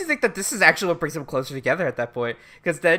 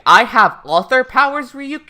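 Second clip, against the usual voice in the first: higher, louder mock boast; exasperated retort; 7 semitones higher, 7 dB louder than usual. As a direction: put down a pestering sidekick. Acted exasperated retort to Ryuk.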